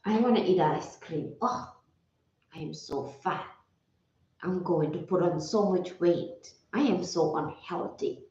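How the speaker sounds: soft, deflated self-scolding; old self-critical voice. Retold or imitated. Imitating her old self-talk for a client, soft and deflated. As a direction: mutter it like you're ashamed of yourself. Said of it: "When my tone of voice was".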